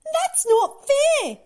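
high, loud, drawn-out protest; protesting cry. A narrator's reading. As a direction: cry out at the unfairness. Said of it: "he cried".

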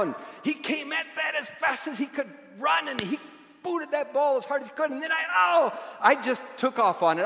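high, shouted build-up; excited storytelling. Real, not acted. Preacher retelling the four-year-old's big kick, excited shout. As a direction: race into the climax at full pitch.

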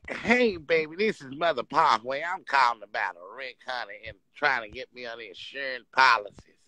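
put-on sweet-talking old grandma calling the insurer; old lady voice. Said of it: "I'm acting like I'm the old lady".

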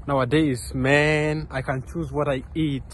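ordinary talk, nothing marked

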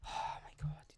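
exasperated whisper. Real, not acted. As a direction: hiss it under your breath in disbelief. Exasperated aside reading comments.

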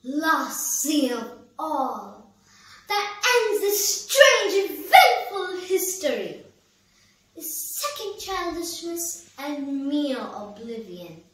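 grand swell sinking into slow, spaced-out, fading phrases; solemn theatrical ending to a verse monologue; poem recitation. Acted. Recites closing lines.

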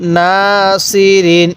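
না held long, then level সি রিন; recitation model. Shown as a demonstration. Teacher showing how to read, drawn-out না সি রিন. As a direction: stretch না and chant সি রিন evenly. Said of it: "আরেকবার পড়ুন".

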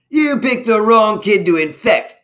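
high, loud threatening yell. A narrator's reading. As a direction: yell it at the intruder. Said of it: "yelled another".

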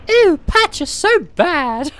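squeaky high, slow disgusted voice; high girly voice. Acted.

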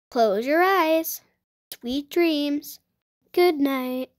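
calm storybook read-aloud; story narration. Acted. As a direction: read it gently to a child at bedtime. Reads picture book aloud.

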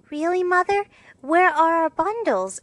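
put-on high-pitched, puzzled little girl; literal young girl. A narrator's reading. Narrator voices Amy, very literal girl.

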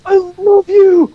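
high, slow elated cry; overjoyed. Real, not acted. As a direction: gush out relieved joy. Rescued, overjoyed.

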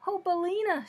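high, loud joyful cry of greeting; glad cry. A narrator's reading. Dorothy greets her hen with a glad cry. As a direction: cry out gladly to a dear old friend. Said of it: "uttering at the same time a glad cry".

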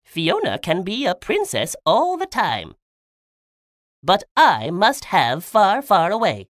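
high-pitched villain driving a deal; scheming Rumpelstiltskin bargaining. A narrator's reading. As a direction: make the bargain high and emphatic. Narrator voices the scheming Rumpelstiltskin.

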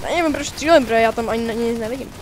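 ordinary talk

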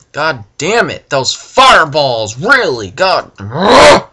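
loud, high frustrated yelling at fireballs; angry outburst. Real, not acted. Gamer yelling at the fireballs, angry outburst. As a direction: blow up at the game. Fireballs keep killing him.

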